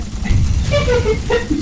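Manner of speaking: high-pitched giggling burst; high giggle. Real, not acted. Storyteller amused by the cake part, high giggle.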